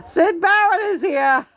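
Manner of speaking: high, laughing and delighted; laughing excited announcement. Real, not acted. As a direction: announce him through laughter. Announcing the spirit's arrival.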